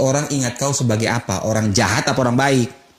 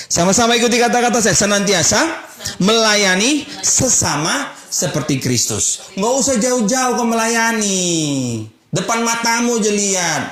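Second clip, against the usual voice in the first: full-force preaching; 10 semitones higher than usual. Acted. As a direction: call the congregation to repeat after you. Preacher leading congregation.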